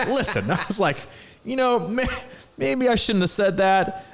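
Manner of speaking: high-pitched, amused retelling; sheepish backpedaling. Retold or imitated. Retold backpedaling to his child.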